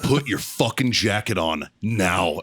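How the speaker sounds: low, slow, deliberate command; imitated low-voiced order; stern order. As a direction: give a measured military order, slowly. Retold order to the midshipman.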